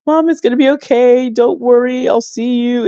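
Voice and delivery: a hint of a comforting teen; imitating reassuring daughter. Daughter comforting crying mother, retold.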